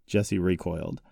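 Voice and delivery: usual speaking voice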